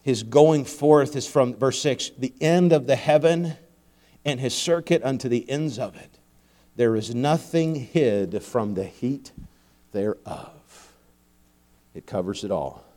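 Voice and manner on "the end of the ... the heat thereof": slow, measured Bible reading, lower and softer; scripture reading. Acted. Preacher reading Psalm to congregation, solemn scripture reading. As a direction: read the verse with quiet reverence.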